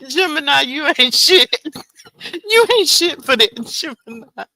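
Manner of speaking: loud teasing insult through laughter; laughing mock scold. Real, not acted. Loud laughing mock scolding of Gemini in chat. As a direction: scold him playfully while cracking up.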